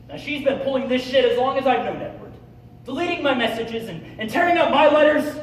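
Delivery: high, loud outburst, building to a yell; angry rant. Acted. Ranting about Gloria after call.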